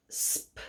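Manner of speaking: a hissed "Sp" with no vowel; isolated sp sound. Shown as a demonstration. Teacher to kids, modelling "Sp" alone. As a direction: say just "Sp", s gliding into a popped p. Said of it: "Repeat with me".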